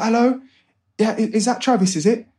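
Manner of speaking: high, bouncy caller bursting with energy; a big ball of energy down the phone line; energetic Darkus. Retold or imitated. Darkus cold-calling Travis, high and full of energy. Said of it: "Darkus with a big ball of energy".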